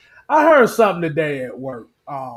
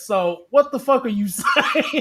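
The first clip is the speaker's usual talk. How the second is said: laughing disbelief; 3 semitones higher than usual. Retold or imitated. Baffled host retelling his reply to a driver, breaking into laughter.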